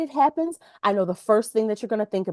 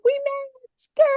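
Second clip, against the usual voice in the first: high, bubbly mock girl voice; girly squeal voice; much slower than usual. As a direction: squeal like excited girlfriends. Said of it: "and it's like".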